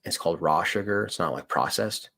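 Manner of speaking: normal talking voice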